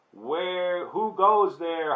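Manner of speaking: high, drawn-out call into space; hollering. A narrator's reading. Space traveller challenges whoever is passing, hollering. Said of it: "hollered Scapito".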